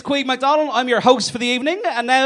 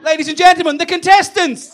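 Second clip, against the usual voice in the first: raised, higher announcer call; loud showman's flourish; rousing stage introduction; 6 semitones higher, 5 dB louder than usual. Acted. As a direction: present them for big applause. Host presents panel for applause.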